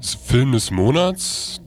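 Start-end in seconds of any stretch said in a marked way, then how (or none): none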